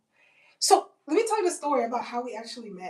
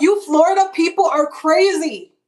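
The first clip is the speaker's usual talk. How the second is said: loud, higher-pitched exclamation; exasperated shout; 7 semitones higher, 14 dB louder than usual. Retold or imitated. Visitor reacting to the friend in Florida, loud high shout. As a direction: shout it out in disbelief.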